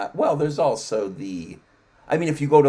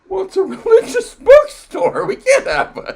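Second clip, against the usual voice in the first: high laughing mock voice of the owner, louder; mock store owner; 6 semitones higher, 8 dB louder than usual. Mocking the bookstore owner who refuses atheist books, high and laughing. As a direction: lampoon the owner through a laugh. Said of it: "He goes".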